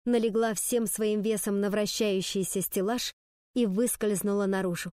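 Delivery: usual speaking voice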